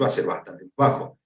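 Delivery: ordinary talk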